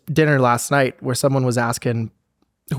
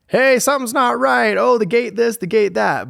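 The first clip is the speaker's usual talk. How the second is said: higher-pitched mock tenant reporting a problem; complaining tenant; 8 semitones higher than usual. Tenant flagging a gate problem to the owner, mock complaining. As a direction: put on a high, pestering caller's voice.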